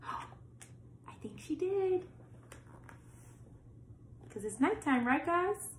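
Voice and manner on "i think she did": gasp, then slow and hushed. Real, not acted. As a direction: breathe it out in slow wonder. Child reader discovers Night returned.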